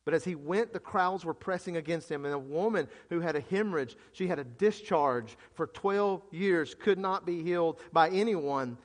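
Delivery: ordinary talk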